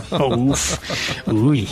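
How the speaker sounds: normal talking voice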